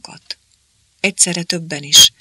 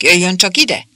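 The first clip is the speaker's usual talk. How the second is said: calling out; 4 semitones higher, 7 dB louder than usual. A narrator's reading. Calling Márika over.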